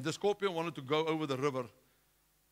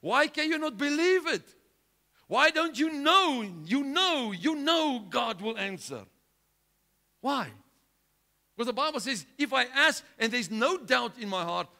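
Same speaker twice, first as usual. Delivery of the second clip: loud, high, ringing preacher's cry; full-force preaching; 9 semitones higher, 8 dB louder than usual. Acted. Preacher urging the congregation to believe, at full force. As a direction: preach it out to the whole church.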